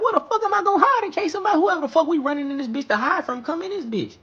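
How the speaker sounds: panicked. Retold or imitated. Boy hunting for a hiding place, frantic high squeal. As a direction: squeal it fast like you're about to get caught.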